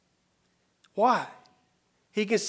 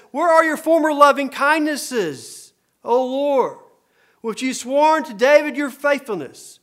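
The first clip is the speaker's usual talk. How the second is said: impassioned scripture reading; 5 semitones higher, 6 dB louder than usual. Acted. Psalmist's plea read aloud.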